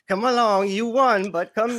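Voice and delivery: high, teasing come-along call. Pretend. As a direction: lure them along playfully. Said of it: "Be like".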